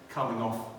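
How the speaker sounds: ordinary delivery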